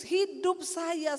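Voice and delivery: ordinary talk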